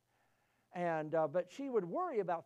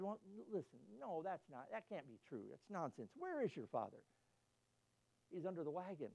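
quiet punchline; 8 dB quieter than usual. Retold or imitated. Punchline of joke, farmer and boy.

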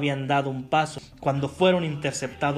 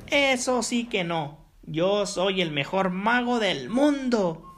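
very high, loud, slow shriek; shrieking tantrum; 10 semitones higher, 4 dB louder, slower than usual. Acted. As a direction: throw a furious wizard tantrum. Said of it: "chilló el hechicero".

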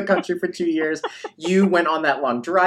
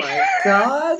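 surprised gasp. Real, not acted. Surprised by the props.

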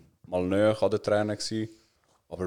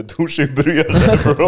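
loud, high-pitched exclamation through laughter; laughing outburst; 7 semitones higher, 12 dB louder, slower than usual. Real, not acted. Friend jokes about crying in the shower, laughing outburst. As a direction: crack up while calling it out, voice shooting up.

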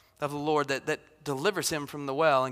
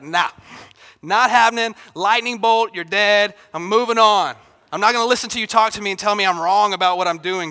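loud, higher mock divine brush-off; dismissive refusal; 3 semitones higher, 8 dB louder than usual. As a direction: shut the complainer down, loudly and briskly. Preacher acts himself as dismissive Lord.

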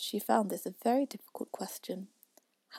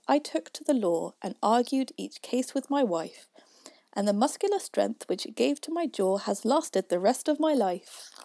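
louder, rhythmic verse; poem recitation; 2 semitones higher, 6 dB louder than usual. A narrator's reading. Youth questions Father William, in loud recitation. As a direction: recite it out with a firm beat.

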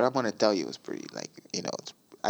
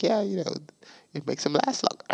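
higher-pitched take on her offhand remark; imitating a breezy admission; casual imitation; 8 semitones higher, faster than usual. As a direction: toss it off as if it's nothing. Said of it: "like, such a casual way".

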